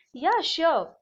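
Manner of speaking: high, loud cheerful duck. Acted. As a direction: answer brightly as the duck. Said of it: "The duck said".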